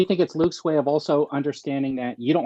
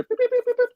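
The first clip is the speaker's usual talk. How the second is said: high droid beeping, much higher; imitated droid beeps. Friend imitating R2 beeping at the ship, high droid beeps. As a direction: beep like a little astromech droid. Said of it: "R two is up there".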